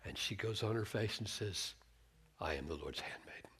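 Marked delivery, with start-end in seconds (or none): 2.4-3.5 much softer and lower, a bowed whisper; humble whisper. Retold or imitated. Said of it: "Totally different attitude".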